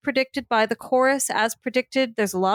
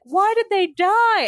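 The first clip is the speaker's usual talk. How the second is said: much higher, louder and slow wail; mock exclamation; 10 semitones higher, 4 dB louder, slower than usual. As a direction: cry out in disbelief, drawn out. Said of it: "they exclaimed".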